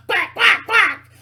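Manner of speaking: loud high duck quacking after a laugh; high mocking quacks. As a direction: quack at them loudly. Mocks believers as quacks.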